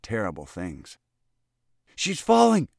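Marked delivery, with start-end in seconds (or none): 1.9-2.7 high, loud panicked pant; boy panting a false alarm. Acted. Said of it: "panted Freckles".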